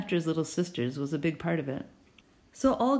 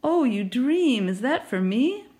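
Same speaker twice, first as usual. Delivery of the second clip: slow, loud, higher gushing; delighted gush; 7 semitones higher, 5 dB louder, slower than usual. A narrator's reading. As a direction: gush over the root beer. Neighbor delighted by drink.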